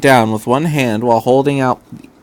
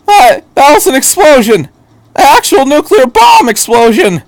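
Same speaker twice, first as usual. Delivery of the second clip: much higher, loud stunned outburst; shocked disbelief; 13 semitones higher, 7 dB louder than usual. Acted. Shinji shouting in shock at the doctor, disbelieving. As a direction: blurt it out in total disbelief.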